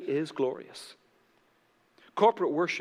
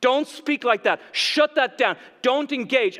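high, loud, commanding rebuke; forceful acted silencing; hostile enemy; 8 semitones higher, 7 dB louder than usual. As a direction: shout down the believer as the enemy. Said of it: "your enemy is like".

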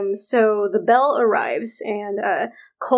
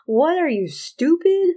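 a hint of an irritated French worker, higher; mocking French guy; 7 semitones higher than usual. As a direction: lightly sneer it like a rude Frenchman. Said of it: "And the French guy is like".

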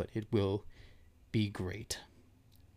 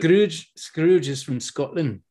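put-on slow, raised, loud Scottish accent; 6 semitones higher, 10 dB louder, slower than usual. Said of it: "I couldn't do a Scottish accent".

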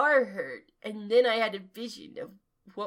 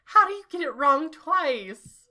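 loud, higher exasperation; exasperated; 7 semitones higher, 5 dB louder than usual. Real, not acted. Reader aghast at the second misspelling, exasperated. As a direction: complain loudly at the botched name.

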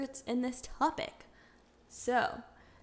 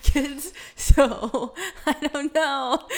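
laughing; 6 semitones higher, 11 dB louder, much slower than usual. Real, not acted. Laughing at own admission.

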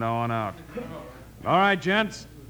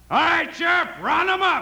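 calling out; 4 dB louder than usual. Acted. Hickok calls out to sheriff.

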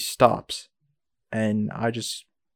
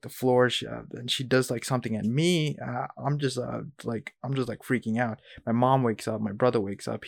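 ordinary talk

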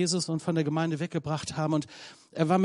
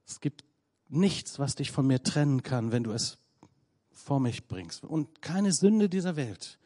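God's tender invitation; 3 semitones lower, 4 dB quieter than usual. Pretend. God invites the sinner home, tender and soft. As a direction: whisper God's welcome like a father.

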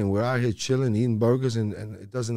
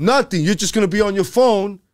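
loud insistent; 9 semitones higher, 7 dB louder than usual. Real, not acted. Insisting life goes on.

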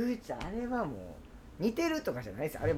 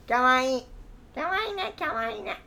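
put-on high, cooing baby talk, teasing; mock-adoring coo, pitched high; cutesy teasing voice; 7 semitones higher than usual. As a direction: coo it at him like he's a toddler. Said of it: "なんでその声なんだろうな".

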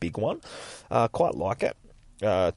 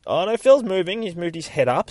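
high-pitched mock alarm; startled exclamation; 9 semitones higher, 4 dB louder than usual. Storyteller spotting the injured man moving, startled exclamation. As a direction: jump up in pitch at the sudden twist. Narrator reacts to sudden twist.

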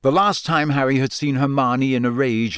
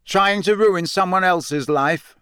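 raised, slower accusing voice; higher, measured challenge, mildly loud; 7 semitones higher, slower than usual. A narrator's reading. Harry confronting Rita across the pub, loud. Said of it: "said Harry loudly".

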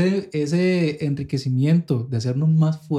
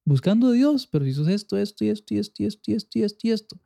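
higher, fast scornful listing; mocking accusers; 6 semitones higher, faster than usual. Imitating people who judge a new believer, scornful. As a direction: rattle off their faults mockingly. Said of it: "la gente te dice".

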